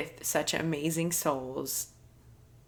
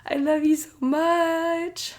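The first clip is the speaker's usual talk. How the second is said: retold crying; 12 semitones higher, 6 dB louder than usual. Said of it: "I'm, like, crying".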